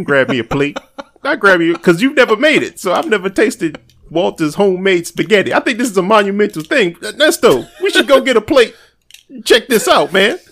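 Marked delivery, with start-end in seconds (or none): 1.2-10.4 hyped teasing. Real, not acted. Hyping up homemade spaghetti.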